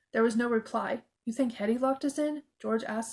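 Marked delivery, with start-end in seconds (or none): 1.2-2.4 mildly surprised, rising question; a touch of surprise. A narrator's reading. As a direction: ask it in disbelief.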